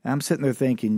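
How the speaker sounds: usual speaking voice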